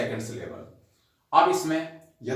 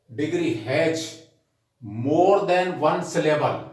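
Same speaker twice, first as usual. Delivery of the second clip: slow rule dictation; 3 semitones higher, much slower than usual. Acted. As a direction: dictate the rule word by word for notes. (छात्रों को notes लिखवाते हुए बहुत धीमा, ऊँचा श्रुतलेख)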